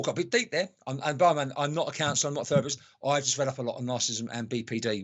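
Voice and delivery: usual speaking voice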